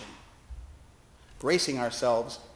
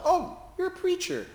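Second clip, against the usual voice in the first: very high, bright humoring tone; bright question. Retold or imitated. Chaplain humoring the preacher to get away, bright and high. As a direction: humor him with a chirpy voice. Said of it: "I asked brightly".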